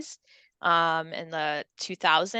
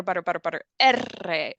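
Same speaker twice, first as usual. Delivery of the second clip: butter drill into R. Shown as a demonstration. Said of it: "you could go butter".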